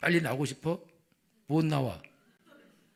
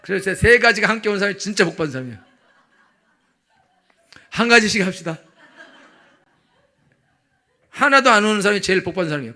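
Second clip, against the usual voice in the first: laughing preaching; 3 semitones higher, 8 dB louder, faster than usual. Real, not acted.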